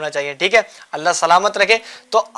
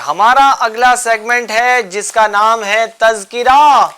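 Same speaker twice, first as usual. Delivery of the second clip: drawn-out, building announcer call; segment announcement; 2 semitones higher, 4 dB louder, much slower than usual. Real, not acted. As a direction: announce it big and slow, stretching the last word. Host announces the next segment. (بلند آواز میں کھینچ کر اعلان)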